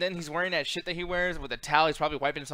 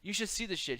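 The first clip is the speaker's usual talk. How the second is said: ordinary talk, nothing marked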